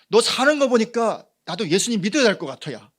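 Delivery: high, loud, eager begging; pleading excited friend. Retold or imitated. Friend begging to be taken to church, high and loud. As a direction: beg like a friend who wants what you have. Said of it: "지금 말로 어떻게 말할 수 있나요".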